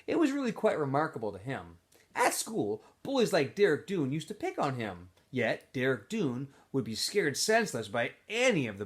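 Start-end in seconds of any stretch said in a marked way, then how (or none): none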